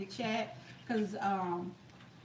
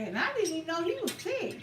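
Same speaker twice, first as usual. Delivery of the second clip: usual speaking voice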